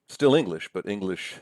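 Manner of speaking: usual speaking voice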